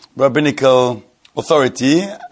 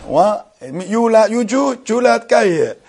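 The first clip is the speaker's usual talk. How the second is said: astonished disbelief; 8 semitones higher than usual. Retold or imitated. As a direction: pitch climbs high in retold amazement. Retelling his own astonished reply.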